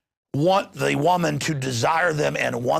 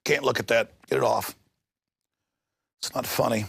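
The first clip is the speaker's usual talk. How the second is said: ordinary delivery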